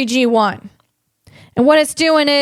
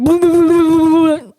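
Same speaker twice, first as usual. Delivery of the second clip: dazed robot babble; 3 semitones higher, 3 dB louder than usual. Retold or imitated. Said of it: "He's like".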